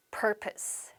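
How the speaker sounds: "Purpose" whispered, stress on PUR; correct pronunciation. Shown as a demonstration. Teacher whispering the correct "Purpose" after a wrong one. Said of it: "한 번 더".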